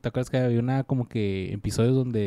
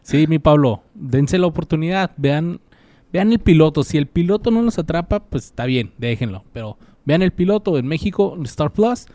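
emphatic urging; 8 dB louder than usual. Real, not acted. Urging listener to watch pilot.

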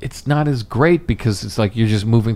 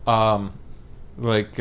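ordinary delivery

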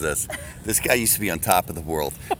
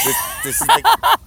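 laughing; 6 dB louder, slower than usual. Real, not acted. Laughing while cutting in.

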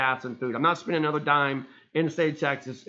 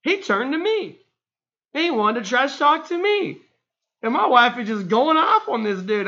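normal talking voice

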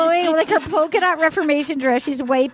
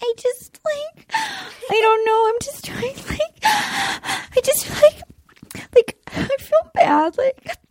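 high whiny tearful pleading; hammed-up high crying plea; performing her tearful begging for friends; Victoria impression; 6 semitones higher than usual. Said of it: "Could you do ... in her voice?".

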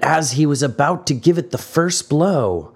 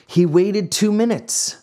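ordinary delivery

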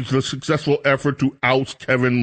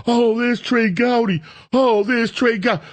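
high, swooning fan voice; mock adoring fan; gushing fan; 8 semitones higher than usual. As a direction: gush like a starstruck TV viewer. Mocking fans gushing over Gowdy.

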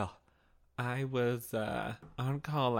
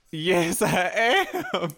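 eager yes; 4 semitones higher, 7 dB louder, much slower than usual. Retold or imitated. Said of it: "And I said".

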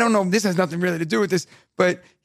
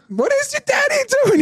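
much higher, scandalised grandma; shocked grandma. Retold or imitated. Grandma asking the boy, in a high shocked voice. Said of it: "my mom's like".

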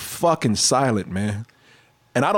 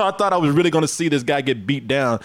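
ordinary talk, nothing marked

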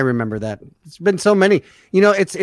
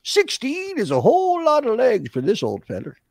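put-on high, stretched old-feller voice; old feller character; 5 semitones higher than usual. Showing off the new character, high old-feller voice. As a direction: repeat it as a high comic character. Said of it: "it's just like my character".

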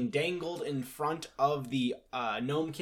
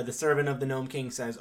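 ordinary talk

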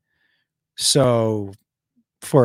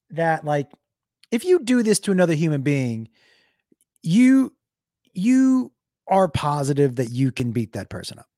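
ordinary delivery

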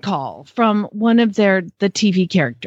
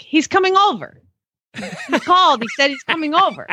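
put-on high, insistent child; imitated little boy; 5 semitones higher than usual. Mom mimicking her son insisting on the visit, high child voice. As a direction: mimic a kid who is sure of it, pitched up. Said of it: "He's like".

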